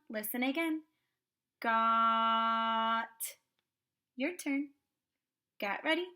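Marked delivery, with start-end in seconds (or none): 1.6-3.1 "Got" with the vowel held long, louder, then a quick t; sounded out slowly. Shown as a demonstration. Teacher showing the student again, "Got" sounded out slowly. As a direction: draw out the middle of "Got" on one level note. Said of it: "This is a fast sound".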